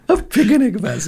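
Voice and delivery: high, laughing mock Japanese accent. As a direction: giggle through a put-on Japanese accent.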